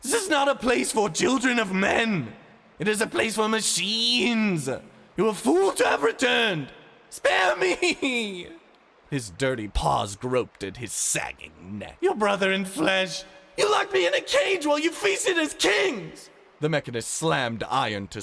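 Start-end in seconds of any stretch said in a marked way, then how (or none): 0.0-8.5 high, loud desperate cries; a beaten man shouting for mercy; pleading shriek. Acted. Ivan begging, shrieking. 12.0-16.3 very high, loud screamed rebuke; enraged shouting girl; furious scream. Acted. Ani beating Ivan, raging.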